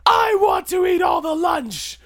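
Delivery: high, slow whiny shout; bratty kid shout. Pretend. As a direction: yell it like a greedy child. Mock kid Kratos shouting.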